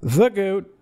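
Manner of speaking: high, loud playful exclaim; gleeful nickname. Real, not acted. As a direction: cheer the nickname out.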